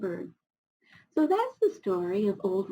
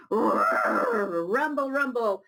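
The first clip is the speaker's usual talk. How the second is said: rumbling sound; much slower than usual. Shown as a demonstration. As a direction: rumble it like the ground shaking. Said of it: "Can you make a rumbling sound".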